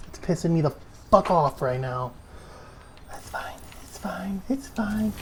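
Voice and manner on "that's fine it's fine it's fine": hushed breathy reassurance; soft whispered self-soothing. Real, not acted. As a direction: whisper it to calm yourself. Calming herself after rage.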